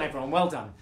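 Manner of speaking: plain reading voice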